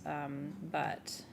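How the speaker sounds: normal talking voice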